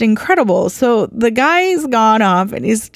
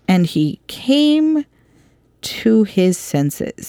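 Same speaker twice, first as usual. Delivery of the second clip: slow, weighty reading of the verse; scripture reading; 3 semitones higher, slower than usual. Acted. Preacher reads the verse to the congregation, slow and solemn. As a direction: lean into each word like holy text.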